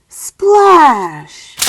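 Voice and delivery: loud punched splash; splashy sound word; big splash. Acted. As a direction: make the splash land.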